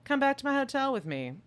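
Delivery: high, loud, fast breathy come-on; mock Carrie. Carrie luring Charles back to her hotel, hammed-up high come-on.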